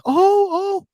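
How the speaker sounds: very high imitated ooh; impressed cooing. Nurse reacts to proud patient's boast, impressed cooing. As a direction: coo as if suitably impressed.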